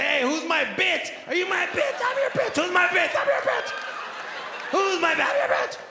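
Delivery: hammed-up, climbing to a screech; loud falsetto bedroom banter; wild bedroom talk. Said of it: "just say".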